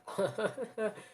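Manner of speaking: high-pitched put-on laughing; acted laugh. A narrator's reading. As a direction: laugh in character, pitched well up. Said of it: "Asta just laughed and said".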